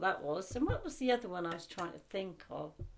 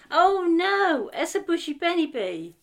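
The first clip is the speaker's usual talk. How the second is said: excited recognition; 9 semitones higher, 11 dB louder than usual. Real, not acted. Suddenly recognising the picture.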